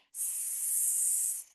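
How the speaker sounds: long voiceless sss; snake hiss. Pretend. Teacher being the snake that is back, long soft hiss.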